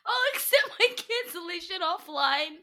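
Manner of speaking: high, slow, giggly mimicking; laughing imitation. Repeating co-host's absurd line, laughing imitation. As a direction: repeat it helplessly amused, voice climbing.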